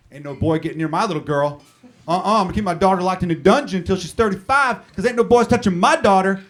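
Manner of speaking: loud, blustering Southern father; Southern accent, rising to an indignant holler; Southern dad. Acted. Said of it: "I don't know why I make 'em Southern".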